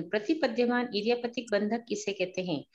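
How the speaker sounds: ordinary delivery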